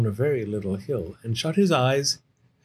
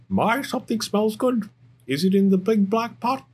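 high, lively storybook duck; curious Duck; 11 semitones higher than usual. Acted. Duck sniffing the soup pot, high and eager. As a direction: play an eager duck, pitched well up.

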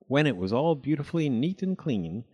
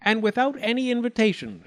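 higher, prim mouse voice; higher prim little mouse; fussy little mouse; 10 semitones higher, 4 dB louder than usual. Acted. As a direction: politely refuse the greedy guest. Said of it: "said Missus Thomasina Tittlemouse".

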